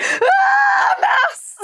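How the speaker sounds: high loud shriek of mouse; imitated scream. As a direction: scream it in sudden fright. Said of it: "she screams again".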